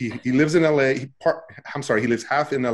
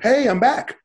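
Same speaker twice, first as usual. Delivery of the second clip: cheerful greeting; 11 semitones higher, 5 dB louder, slower than usual. Retold or imitated.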